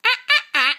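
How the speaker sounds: loud monkey ah ah ah; monkey noise. Pretend. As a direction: do a monkey call.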